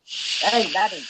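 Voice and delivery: mock Scottish accent. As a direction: make fun with a put-on Scottish accent. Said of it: "unlike the Canadian that's just making fun of him".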